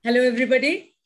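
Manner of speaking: ordinary talk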